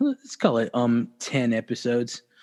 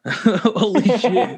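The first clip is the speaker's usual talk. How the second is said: high laughing exclamation; 8 semitones higher, 5 dB louder, much slower than usual. Real, not acted. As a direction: laugh it out, drawn long. Laughing at the name.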